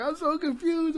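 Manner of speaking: high airy mock-girl voice; ditzy girl voice. Retelling the movie to friends, imitating Zuzu's confused reply in a high voice. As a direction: play it dim and high. Retold movie quote, girl's voice.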